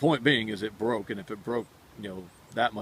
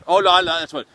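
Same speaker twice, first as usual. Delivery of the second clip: declaring; 6 semitones higher, 9 dB louder than usual. Retold or imitated. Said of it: "That's what it was we'd say".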